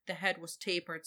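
usual speaking voice

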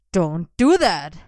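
startled loud yelp, slow. A narrator's reading. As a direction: yelp it out, caught off guard. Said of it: "he yelped".

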